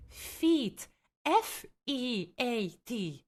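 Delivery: slow, letter by letter; emphatic spelling out. Real, not acted. Streamer correcting chat on a term, slow emphatic spelling out. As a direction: spell it out pointedly, with space between the letters.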